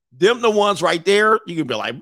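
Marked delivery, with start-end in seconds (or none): none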